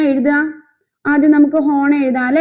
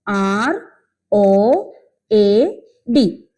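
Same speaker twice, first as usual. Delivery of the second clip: spelling out letters; 4 semitones lower, much slower than usual. Shown as a demonstration. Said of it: "road എന്നുള്ളതിന്റെ spelling".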